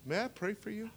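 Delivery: "may I pray for you?" said softly and kindly, pitch lifted; soft, caring ask to a limping man; gentle offer. Shown as a demonstration. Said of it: "you go to him and you say".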